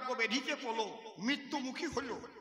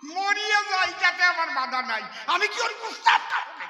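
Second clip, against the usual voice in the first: loud, high, rising protest; patient loudly refusing the doctor's odd remedy, fast at the end; indignant outburst; 7 semitones higher, 9 dB louder than usual. Acted.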